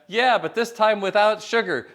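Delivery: retold yell. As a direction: shout the order across the café. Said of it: "And Hal yells back".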